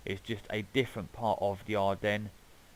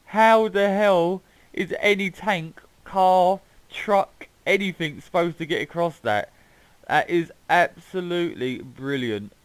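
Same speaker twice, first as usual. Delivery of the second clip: laughing disbelief; 7 semitones higher, 8 dB louder than usual. Real, not acted. Mocking the absurd bridge.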